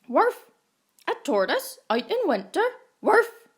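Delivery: dog barking its disbelief. A narrator's reading. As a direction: bark it out like an excited dog. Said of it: "barked the dog".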